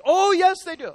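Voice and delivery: high, loud protesting lady; insisting church lady. Retold or imitated. Church lady insisting to the pastor, high and loud. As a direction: squeal the objection.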